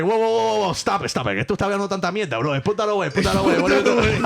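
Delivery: high, loud, rapid Cuban uncle protest. Retold or imitated. Contestant in Cuban uncle character telling judges to put the iPad away, loud. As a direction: wave it all away like an outraged Cuban uncle. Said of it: "I started being a Cuban uncle".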